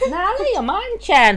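high-pitched Aussie twang; Australian accent. Pretend. Boyfriend repeating it when prompted, mock Australian accent. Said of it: "Uh, Australian".

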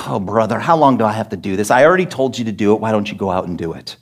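low, soft and quick fed-up grumble; exasperated sigh. Retold or imitated. Preacher imagining Jesus fed up with disciples, low exasperated grumble. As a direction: mutter it like a worn-out parent. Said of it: "There almost seems like".

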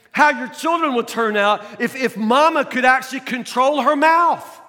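loud, high, driving preacher voice; preaching. Acted. Pastor urging the congregation, full-force preaching.

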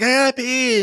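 put-on high, whiny little boy needing the bathroom; little kid voice. Small boy in line telling his parent he has to pee, high and whiny. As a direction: play the desperate small kid.

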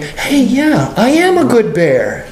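high-pitched, eager agreement; glad affirmation. Acted. Believer saying amen to being a good bear, glad affirmation. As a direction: own it happily, voice lifted. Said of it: "Amen is you saying".

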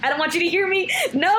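high, loud whiny half-cry; playfully fake-cry the refusal; mock whine. Refusing her boyfriend's request, whining in mock tears.

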